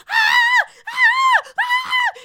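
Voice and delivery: high shrieking ahs; mock screaming. Wendy screaming at the proposal, shrill ahs. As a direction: shriek like an overexcited fan. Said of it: "she's like".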